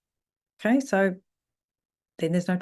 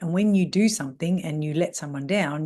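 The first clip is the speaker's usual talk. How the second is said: ordinary talk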